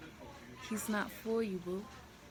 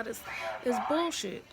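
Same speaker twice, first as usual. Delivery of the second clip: angry outburst. Real, not acted. Venting at boyfriend.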